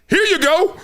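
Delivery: high-pitched mock calf voice. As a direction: squeak it out like a cartoon calf. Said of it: "the calf just says".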